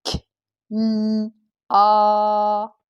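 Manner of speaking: K, l, a as separate drawn-out sounds; crisp K, held l, held a. Shown as a demonstration. Teacher slowly reading a syllable with pupils, sound by sound. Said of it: "je dois lire doucement".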